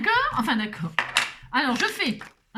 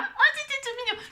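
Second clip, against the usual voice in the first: sudden very high oh, delighted; cooing delight. Real, not acted. Crafter cooing at her cute card. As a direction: squeal over how cute it is.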